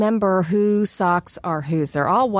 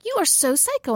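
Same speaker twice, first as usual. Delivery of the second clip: husband teasing; 10 semitones higher, 4 dB quieter than usual. Retold or imitated. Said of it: "he's like".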